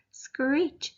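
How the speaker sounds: high animal screech; high, light possum screech. Acted. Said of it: "screech went the possum".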